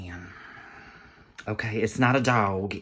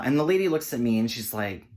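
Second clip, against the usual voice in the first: normal talking voice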